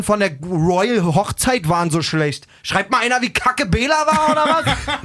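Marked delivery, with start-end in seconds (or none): 2.6-5.1 rage moment. Real, not acted. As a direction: rage through laughter. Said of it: "kurzer Rage-Moment hier".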